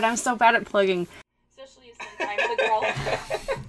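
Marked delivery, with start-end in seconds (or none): 1.6-3.7 high, soft laugh-squeezed words; words dissolving into laughter; helpless laughing. Real, not acted. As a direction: barely get it out through real giggles. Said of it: "now I can't stop laughing".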